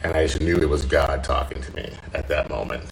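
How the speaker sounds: ordinary talk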